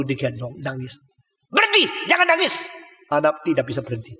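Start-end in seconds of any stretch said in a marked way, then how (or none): 1.5-2.7 harsh shout. Retold or imitated. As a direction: bark the order like a cruel maid. Maid yelling at crying baby.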